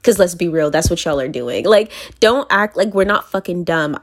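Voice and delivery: ordinary talk, nothing marked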